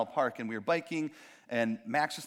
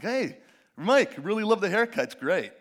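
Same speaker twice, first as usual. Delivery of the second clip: fake nice; 5 semitones higher, 6 dB louder than usual. Fake-nice compliment example.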